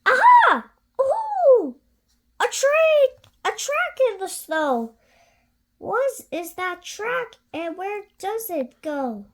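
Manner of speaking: high, loud excited little voice; excited child monster. Acted. Gruffalo's child spotting a trail, high and thrilled. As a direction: discover the trail with glee.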